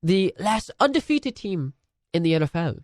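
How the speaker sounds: posh English pundit, mock serious; lightly put-on British broadcaster; English presenter accent. Hosting the picks show in a mock English accent. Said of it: "I can't do an English accent".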